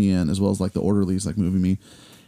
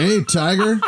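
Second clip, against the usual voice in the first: laughing; 10 semitones higher, slower than usual. Retold or imitated. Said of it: "he's like".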